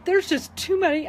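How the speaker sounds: fighting back tears mid-sentence; welling up while retelling her own thoughts; choked up. Real, not acted. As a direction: voice tight with real emotion. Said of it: "I'm sorry, I'm getting emotional".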